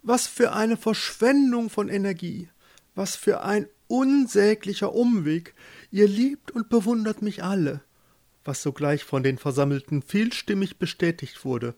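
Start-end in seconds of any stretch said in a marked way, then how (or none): 0.0-7.8 high, declaiming grand voice; haughty lady pope. A narrator's reading. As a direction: lament to your flock from on high. (hochmütige Päpstin, hoch deklamierend)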